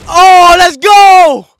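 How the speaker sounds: long, high, loud cheer; slow, drawn-out victory yell; triumphant shout. Real, not acted. Gamer cheering as he beats the boss, triumphant shout.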